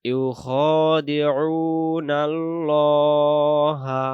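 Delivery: loud, very slow chanted recitation; slow Quran recitation. Shown as a demonstration. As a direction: recite it drawn out like tajweed practice. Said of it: "আবার".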